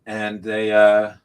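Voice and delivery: speaking in a reverberant space; echoey room sound. Real, not acted. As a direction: echo of a big room. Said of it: "You sound a little bit echoey".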